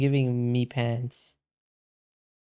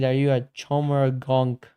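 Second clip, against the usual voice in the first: usual speaking voice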